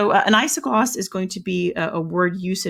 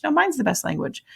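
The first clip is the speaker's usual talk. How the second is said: higher-pitched playful squabbling retort; mock one-upping; 9 semitones higher than usual. Teacher voicing people competing over languages, mock bickering. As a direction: top the rival's boast with a pitched-up mock protest. Acts out rival language speakers.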